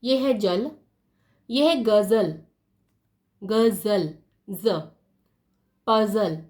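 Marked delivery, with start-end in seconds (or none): 1.8-4.2 गजल as "gazal"; z sound. Shown as a demonstration. Teacher reads the dotted word, गजल with z. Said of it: "नुक्ता लगा दिया". 4.5-4.7 ज alone as "zə"; short buzzed z for ज; z sound. Shown as a demonstration. Teacher isolates the dotted letter, ज as z. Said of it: "नुक्ता लगा दिया".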